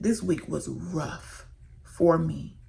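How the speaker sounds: slow, fading into a whisper; fighting back tears. Real, not acted. Telling followers her week was rough, near tears. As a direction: hold back the tears as it drops. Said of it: "I'm fighting back tears".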